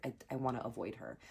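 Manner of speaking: quick, very soft mumble; hushed whisper. Retold or imitated. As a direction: whisper it fast so nobody hears. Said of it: "Like, we're whispering".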